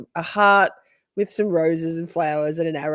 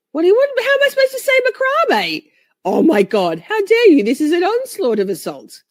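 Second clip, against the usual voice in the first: high, loud indignant protest; mock outrage; 10 semitones higher, 6 dB louder than usual. Teased by viewers over pronunciation, mock outraged. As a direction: squawk in playful offence at being corrected.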